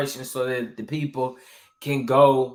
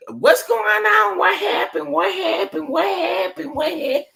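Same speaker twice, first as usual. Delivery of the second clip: mock panicked commenters. Streamer mimicking impatient viewers in the comments, high mock panic. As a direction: imitate fussy viewers in a piercing high voice. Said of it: "boy, y'all be in a".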